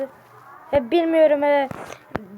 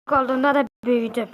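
ordinary talk, nothing marked

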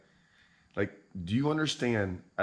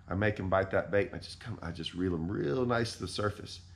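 usual speaking voice

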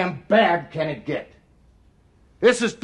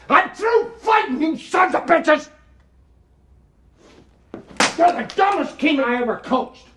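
furious higher yell; halftime pep speech; 6 semitones higher than usual. Real, not acted. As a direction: explode at the players. Coach yelling at team.

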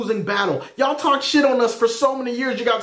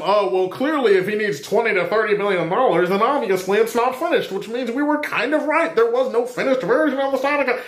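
mocking soapbox critic. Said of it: "talking about some".

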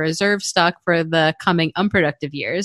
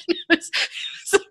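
giggling high through the words; laughing; 12 semitones higher, 3 dB quieter than usual. Real, not acted. Teacher laughing while recalling the gecko student. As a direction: laugh it out breathlessly.

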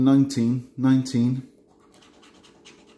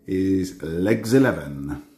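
slow bingo call; bingo caller; 3 semitones lower, slower than usual. Acted. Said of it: "I start doing my bingo calls".

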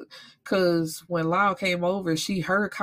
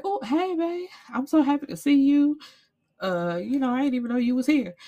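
much higher, sugary put-on cheer; fake sweet greeting; 7 semitones higher than usual. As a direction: play innocent, overly sweet. [name] fakes sweetness to Glockman.

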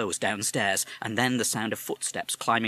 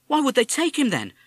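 put-on young boy; 7 dB louder than usual. Boy asks mother.

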